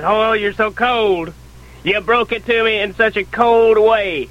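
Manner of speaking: hammed-up hurt; imitated whimpering. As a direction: play the wounded brother, lightly whimpering. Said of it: "He starts whimpering".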